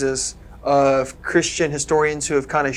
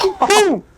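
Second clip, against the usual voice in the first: higher, louder playful boom boom crash noise; mock crash sound; 4 dB louder than usual. Retelling the crash to friends, playful crash-sound imitation. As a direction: pop out a cartoon crash noise. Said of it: "all she sees is a little child go".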